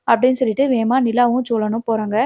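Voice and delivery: ordinary delivery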